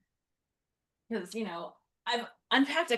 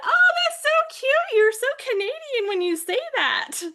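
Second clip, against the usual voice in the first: gushing; 6 dB louder than usual. Retold or imitated. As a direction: gush over it like it's adorable. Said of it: "they said".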